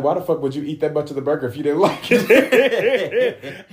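loud, high-pitched retort with laughter; imitating a friend's outraged comeback; indignant comeback. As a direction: throw the question back, loudly. Friend's heated comeback acted out.

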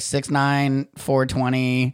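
slow fake address. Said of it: "I was like".

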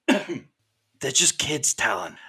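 a lightly voiced vigilante stopping the fight, very slow; vigilante calling out. Vigilante woman telling Talon to stop, slow drawn plea. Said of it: "and she says".

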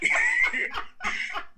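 high, loud cackling laugh. Real, not acted. Speaker amused by their own Spanish joke, laughing high. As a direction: crack up loudly at your own joke.

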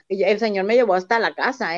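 ordinary talk

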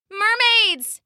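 calls out high across the water; calling out. Acted. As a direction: shout to the distant mermaids. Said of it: "he called".

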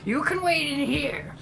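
put-on very high, thin old lady voice; sweet old lady. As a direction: speak like a frail little granny. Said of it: "she explains".

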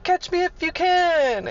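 very high, slow mocking taunt; taunting foreman. Foreman driving off in his scissor lift, high slow taunt. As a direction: tease her like a kid before a chase. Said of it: "And he's like".

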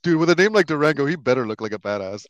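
thrilled, loud and high; excited outburst. Real, not acted. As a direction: gush over the badass name. Excited gushing about cool name.

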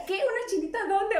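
high-pitched panicky question; hammed-up confused alarm; mock panic. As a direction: act startled, searching in comic alarm. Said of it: "pánico, ¿no?".

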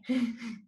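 light amused laugh; quick laugh at own joke; short laugh. Real, not acted.

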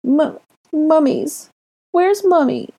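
put-on very high, stammering child's squeal; squealing toddler. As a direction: squeal tearfully for mummy. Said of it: "Ori squealed".

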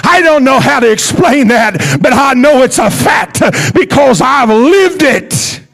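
high, loud preaching shout; preaching at full force. Real, not acted. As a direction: ring it out to the congregation.

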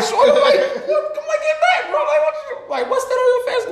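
very high, laughing get-back yelp; high startled squeal at a pushy kid. Retold or imitated.

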